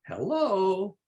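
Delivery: long drawn-out "hello", swooping high; playful hello. Real, not acted. Caller says hello back to toddler, playfully drawn out. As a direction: stretch the greeting out for a little one. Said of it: "I'm saying hello".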